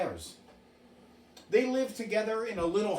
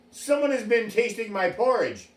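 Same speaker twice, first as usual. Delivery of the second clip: big booming Papa Bear, a bit higher and louder; great big voice; 4 semitones higher, 4 dB louder than usual. Acted. Papa Bear finds his porridge tasted, great big voice. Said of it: "said he in a great big voice".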